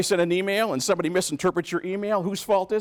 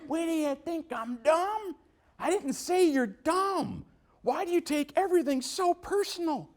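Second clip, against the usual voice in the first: higher, defensive mock snap; mock-offended coworker, pitched up; testy coworker; 10 semitones higher than usual. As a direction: play the touchy coworker who feels accused. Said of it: "They might get testy".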